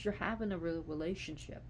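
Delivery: ordinary delivery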